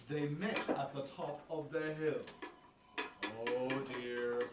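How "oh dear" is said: plain reading voice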